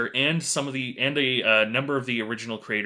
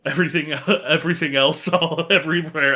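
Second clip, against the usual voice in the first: laughing; 4 semitones higher, 5 dB louder, faster than usual. Real, not acted. Laughing riff on catchphrase.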